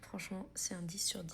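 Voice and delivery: soft unvoiced whisper. Real, not acted. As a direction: whisper it like a secret. Whispered verdict.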